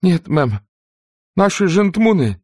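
high breathless cry, gasping; urgent panting report. Acted. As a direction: gasp it out in panic. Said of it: "задыхаясь, выкрикнула Присси".

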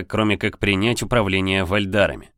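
ordinary delivery, nothing marked

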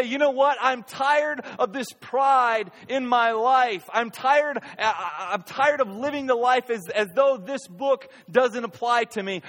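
high, loud repentant prayer, "tired" stressed; earnest confession. Retold or imitated. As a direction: plead sincerely to God about pride. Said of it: "You would say".

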